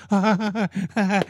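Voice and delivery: high, forced staccato ha-ha; mock nervous laugh. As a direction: imitate an audience laughing nervously. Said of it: "they laugh nervously".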